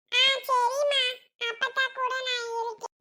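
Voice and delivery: put-on high-pitched little child's voice. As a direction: answer Mum as an obedient small child. சிறு குழந்தை அம்மாவுக்கு பதில். (உயர்ந்த குரலில் சின்னக் குழந்தை)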